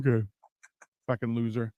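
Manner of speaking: normal talking voice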